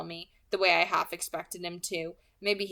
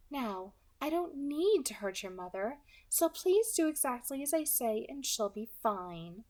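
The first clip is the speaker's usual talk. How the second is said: light, higher, smooth villain voice; pleasant soft alto; 5 semitones higher than usual. Acted. Kidnapper calmly threatening the girl on the phone, pleasant and light. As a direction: menace wrapped in a pleasant voice. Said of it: "a soft alto voice, a very pleasant, generic voice".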